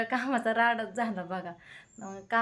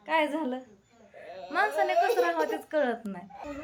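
exasperated; 5 semitones higher than usual. Real, not acted. Exasperated by viewer comments.

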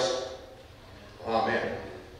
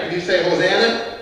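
ordinary delivery

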